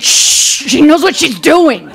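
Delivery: long hissed shush, then an insistent retort; acting out a too-loud shushing; loud mock shush. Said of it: "And very loudly, I said".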